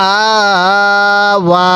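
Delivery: long steady "আ", then long "ওয়া"; stretched vowels. Shown as a demonstration. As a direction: draw out both "আ" and "ওয়া". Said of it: "এরকমভাবে শিখব".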